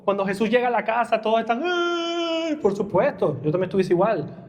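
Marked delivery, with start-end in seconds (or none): 1.6-2.5 mock wail. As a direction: imitate mourners' lament briefly. Mourners wailing at the house.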